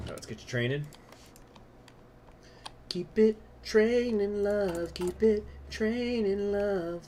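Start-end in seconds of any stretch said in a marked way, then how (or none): 2.9-4.9 high, slow and sweet, coaxing the troops; sing-song coaxing. Acted. Player coaxing his units: high, slow and sweet. 4.9-7.0 high, slow and sweet, coaxing the troops; sing-song coaxing. Acted. Player coaxing his units: high, slow and sweet.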